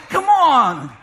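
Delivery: ordinary talk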